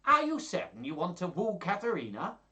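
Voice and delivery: high put-on questioning voice; Gremio, doubtful suitor. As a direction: pitch it up, incredulous.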